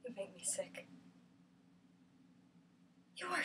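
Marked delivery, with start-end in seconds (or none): 0.0-0.9 low, barely audible accusation; very soft, low, bitter; hushed disgust. Acted. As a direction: say it under your breath with disgust. Acted monologue, bitter accusation.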